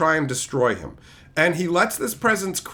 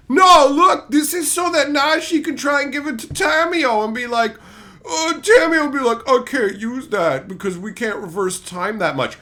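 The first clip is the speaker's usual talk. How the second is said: mock defense; 5 semitones higher than usual. Mock writers defending plot, loud.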